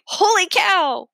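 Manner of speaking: high, loud and slow holy cow; mock amazed exclaim. A narrator's reading. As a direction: cry it out in playful astonishment. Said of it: "And I say".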